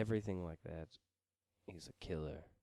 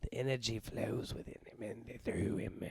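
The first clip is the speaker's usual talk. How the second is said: hammed-up cosmic villain. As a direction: ham up a power-drunk villain. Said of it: "You're like".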